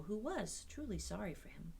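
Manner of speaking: usual speaking voice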